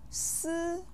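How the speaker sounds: Si held high and level; first tone. Shown as a demonstration. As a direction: keep Si even on a high pitch. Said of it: "with the first tone".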